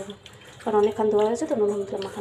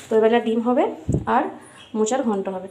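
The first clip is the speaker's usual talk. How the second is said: ordinary delivery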